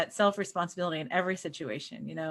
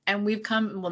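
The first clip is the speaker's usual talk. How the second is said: ordinary talk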